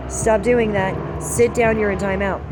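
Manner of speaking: loud stern mom, slow at first; mother scolding her boys, loud and stern; retold scolding. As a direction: bark orders at the kids. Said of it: "'Cause I would say".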